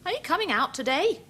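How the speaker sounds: high, quick calling mother voice; mother mouse calls her babies out. Acted. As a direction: call out brightly like a mother mouse. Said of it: "Mother says".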